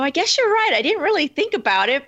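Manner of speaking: surprised agreement. Retold or imitated. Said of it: "And I go".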